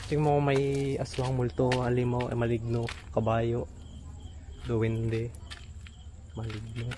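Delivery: low, hushed, trailing off; hushed listing. Real, not acted. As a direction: list them softly in the dark. Listing monsters in the dark. (mahinang pabulong na paglista)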